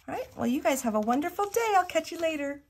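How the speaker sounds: high, quick cheerful goodbye; bright sign-off. Real, not acted. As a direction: wave them off happily, quickly.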